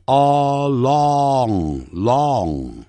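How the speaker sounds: 了 plus a drawn-out vowel, blended into long; slow, stretched long, built up in steps; sounding out. Shown as a demonstration. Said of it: "你也可以练成了".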